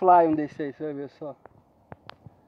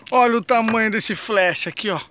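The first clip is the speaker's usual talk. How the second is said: excited; 4 semitones higher, 7 dB louder than usual. Real, not acted. Big fish just landed.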